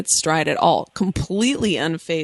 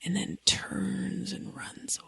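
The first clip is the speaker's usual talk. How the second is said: ghost story whisper, much softer; spooky whisper; 14 dB quieter than usual. Pretend. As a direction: whisper the ending like a campfire tale. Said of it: "how people tell campfire stories".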